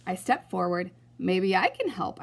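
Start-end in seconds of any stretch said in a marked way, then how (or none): none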